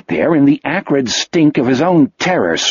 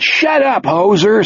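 higher, rude yell; heckling yell; 7 semitones higher than usual. A narrator's reading. Another moviegoer yelling at Wolf, rude heckle. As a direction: tell him off from the seats. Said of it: "someone else yelled".

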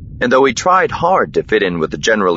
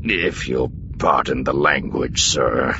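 lower, sheepish afterthought; belated apology; 4 semitones lower, 3 dB quieter, slower than usual. A narrator's reading. Colonel Boss excuses his swearing to a superior, sheepish. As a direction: drop the pitch and apologise, a touch embarrassed. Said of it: "he added belatedly, looking with some embarrassment".

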